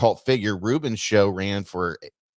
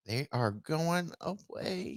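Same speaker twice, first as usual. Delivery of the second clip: high, soft and drawn-out mock lament; softly overplayed, slow and lilting; mock-dramatic; 7 semitones higher, 9 dB quieter, much slower than usual. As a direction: stretch each word slowly, hammed-up and high. Theatrical mocking repeat of the line.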